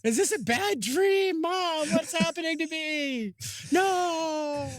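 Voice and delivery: high, panicked, whimpering disbelief; panicked whine. Acted. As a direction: pretend to be terrified and confused. Viewer panics at Viking appearing.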